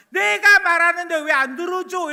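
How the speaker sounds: loud, high, aggrieved complaint; sulky child. Retold or imitated. Child complains to his mother that she is not listening, loud. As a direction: demand to be heard.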